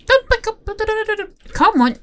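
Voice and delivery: urgent high-pitched coaxing; high urging. Real, not acted. Player urging a horse to come on, high and urgent.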